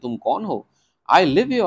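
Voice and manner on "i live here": startled reply. Acted. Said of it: "I said".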